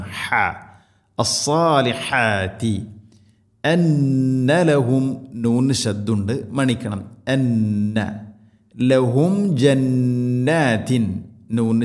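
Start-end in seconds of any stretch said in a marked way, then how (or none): none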